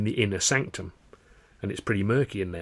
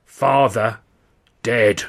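grave pronouncement; 2 semitones higher, 11 dB louder, much slower than usual. Acted. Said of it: "she barks".

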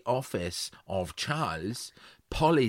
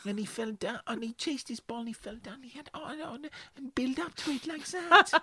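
upset mother; 14 semitones higher than usual. Acted. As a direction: plead in a small high voice, as a joke's mother. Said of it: "She goes".

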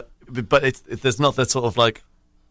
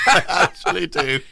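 high-pitched confession through laughter; laughing admission; 9 semitones higher than usual. Real, not acted. Guest owns up to a koozie shelf, laughing. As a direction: laugh while owning up to it.

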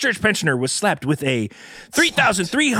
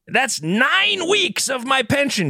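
higher, slow, lightly acted pensioner's complaint; quoting the grandmother; 7 semitones higher than usual. Host reading a fined grandmother's quote, lightly acted complaint. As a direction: draw out her mild outrage. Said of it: "she said".

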